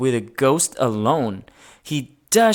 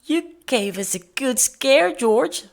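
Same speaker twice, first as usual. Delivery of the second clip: higher, kindly lady voice; Mrs Gray's voice; 10 semitones higher than usual. A narrator's reading. Mrs Gray offering George dress-up clothes, in a kindly voice. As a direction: voice her as a gentle older lady. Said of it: "said Missus Gray".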